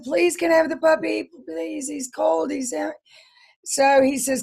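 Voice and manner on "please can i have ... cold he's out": put-on pleading little girl. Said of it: "I said".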